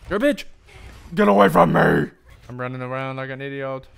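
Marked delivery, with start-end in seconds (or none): 0.1-2.1 high, loud screaming insult; frantic chased yell; panicked shout. Real, not acted. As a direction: yell while fleeing.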